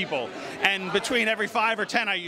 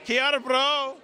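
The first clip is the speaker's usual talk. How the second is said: higher-pitched attempt at a Kiwi accent; 6 semitones higher, slower than usual. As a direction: say it like a New Zealander greeting a mate. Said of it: "your New Zealand Kiwi accent".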